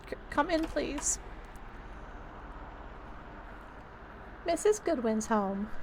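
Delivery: put-on hesitant old-lady welcome; old lady tenant, resigned. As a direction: let the caller in as a frail old woman. Old tenant lets landlord in.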